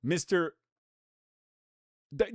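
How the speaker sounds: usual speaking voice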